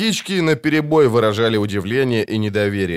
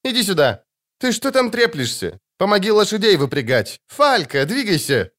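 calling out orders; 9 semitones higher than usual. A narrator's reading. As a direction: hurry them up, yelling. Iskra shouts orders.

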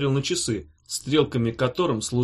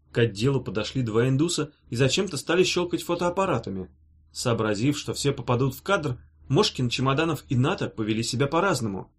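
usual speaking voice

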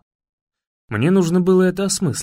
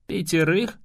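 «Пятерых?» rising at the end as a question; surprised echo question; 2 semitones higher than usual. Acted. Grandson startled to hear of five children, surprised question.

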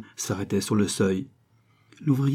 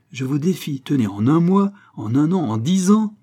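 raised, loud dare; boastful challenge; 5 semitones higher, 6 dB louder than usual. A narrator's reading. Old locksmith daring Malouin to find the trick, boastful. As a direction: throw down a proud challenge.

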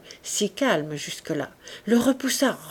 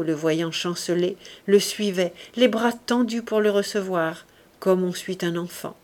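usual speaking voice

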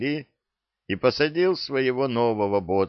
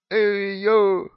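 parrot squawk; 13 semitones higher, 5 dB louder than usual. Acted. Parrot squawks when released.

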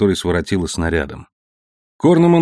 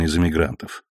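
ordinary delivery

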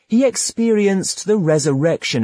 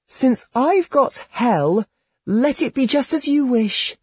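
innocent reply; 11 semitones higher than usual. A narrator's reading. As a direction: answer sweetly and naively. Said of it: "she innocently replied".

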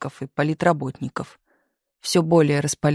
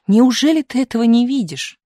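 loud, high exasperated cry; exclaiming; 8 semitones higher, 6 dB louder than usual. A narrator's reading. Misha urging Tumanov to see it, crying out. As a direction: raise the voice in disbelief. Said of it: "вскричал Миша".

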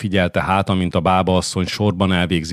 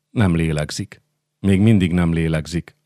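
ordinary delivery

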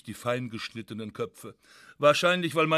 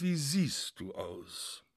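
siehst leaned on, slow and soft; slow hushed question, hitting siehst; stress on siehst; 7 dB quieter, much slower than usual. A narrator's reading. Wife to Heinrich, shocked, stressing siehst. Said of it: "Siehst betont".

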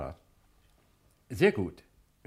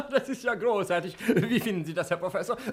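hearty laugh, then talk through it; amused director laughing as he speaks; laughing delight; 8 semitones higher, 5 dB louder than usual. Acted. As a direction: laugh out loud and keep chuckling. Director delighted by prisoner's remark.

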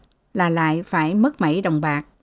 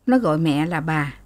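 usual speaking voice

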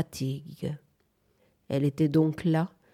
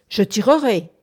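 loud, higher warning; raised-voice warning to an intruder; cried threat; 4 semitones higher, 6 dB louder than usual. A narrator's reading. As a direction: shout the threat. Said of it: "cria March".

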